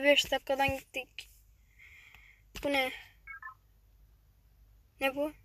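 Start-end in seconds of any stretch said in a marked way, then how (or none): none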